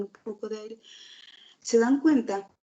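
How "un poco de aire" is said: leer rápido de un tirón, perdiendo volumen; sin respirar. Shown as a demonstration. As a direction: suelta todo en una sola respiración, apagándote al final. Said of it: "si yo no respiro, el volumen de mi voz empieza a descender". (lectura apresurada que se queda sin aire)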